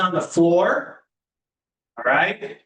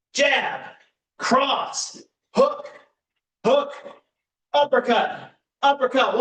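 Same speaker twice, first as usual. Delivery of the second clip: higher, punchy call of each punch; boxing coach calling combos; 5 semitones higher, slower than usual. Acted. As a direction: bark each punch out for the class.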